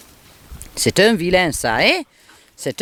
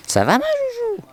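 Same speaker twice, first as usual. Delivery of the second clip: sudden high-pitched cooing; high pet voice. Real, not acted. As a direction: squeak it sweetly to the dog. Cooing to her dog.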